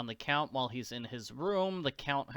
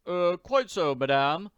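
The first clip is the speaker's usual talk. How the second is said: higher, louder deferential servant; obedient servant; 4 semitones higher, 6 dB louder than usual. Acted. Servant accepting orders from Missus Warbucks, deferential. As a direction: answer the mistress dutifully. First servant answers mistress.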